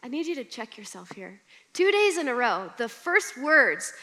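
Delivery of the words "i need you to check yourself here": gentle divine rebuke. Retold or imitated. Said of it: "the Lord is like".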